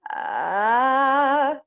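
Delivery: a strong, clear "ah" held without breath in it; clear sung ah. Shown as a demonstration. Voice teacher demonstrating a clean tone to students, clear firm ah. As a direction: show how "ah" sounds with no air leaking. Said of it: "when you do this".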